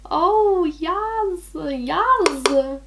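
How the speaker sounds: high, drawn-out swooning approval; drawn-out delight. Real, not acted. Admiring the sheen on her cheeks, drawn-out delight. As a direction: revel in it, stretching each word high.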